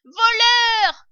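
very high, loud scream; howling. A narrator's reading. Rat shrieking after the cider thieves, howling. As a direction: scream the accusation, stretching the word. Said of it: "hurlait le rat".

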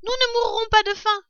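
high, loud little fox cub, excited; a young cub bursting with news; eager fox cub. A narrator's reading. Fox cub waking his starving mother, eager high voice.

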